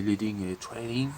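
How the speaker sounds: put-on leading and trailing in a jokey English accent; mock English accent. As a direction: toss off the English words in a hammed-up English accent. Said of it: "Je fais mon accent anglais de qualité".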